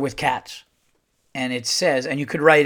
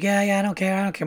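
daughter's voice; 7 semitones higher, faster than usual. Retold or imitated. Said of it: "She's like".

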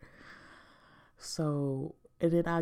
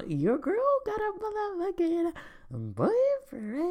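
high, slow, sing-song reveal; gleeful squeal of big news; excited announcement. Real, not acted. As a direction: drag it out, bursting with news.

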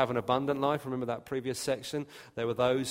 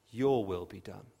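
God's final verdict; 5 semitones lower than usual. Acted. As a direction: speak as God, low and grave. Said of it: "and those to whom God says".

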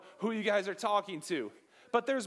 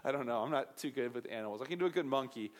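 ordinary talk

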